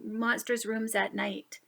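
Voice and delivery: ordinary delivery, nothing marked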